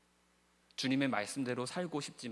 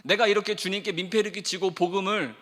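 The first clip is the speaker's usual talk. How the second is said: louder, higher anguished confession to the Lord; Peter pleading with Jesus; 8 semitones higher, 11 dB louder than usual. Acted. Peter confessing his failure to Jesus, anguished pleading. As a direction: pour out Peter's shame and longing, pleading. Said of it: "베드로가 뭐라고 합니까?".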